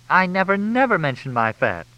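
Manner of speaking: high-pitched indignant protest; protesting in a high character voice; indignant denial. A narrator's reading. Crystal denies it in the quarrel.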